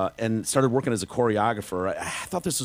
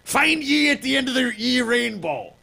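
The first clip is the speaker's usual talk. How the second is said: much higher, slow leprechaun Irish shout; hammed-up Irish accent; 13 semitones higher, 5 dB louder than usual. Echoing his co-host's joke in a loud hammed-up Irish leprechaun voice. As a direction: bellow it like a cartoon Irish leprechaun. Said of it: "now you're going Irish".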